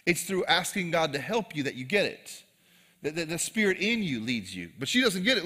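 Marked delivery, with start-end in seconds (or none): none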